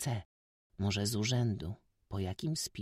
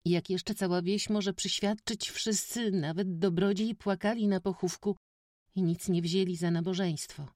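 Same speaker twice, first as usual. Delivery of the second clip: put-on eager village boy; 10 semitones higher than usual. A narrator's reading. Witek excitedly tells the stranger village gossip, high boy voice. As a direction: tell it all in a rush.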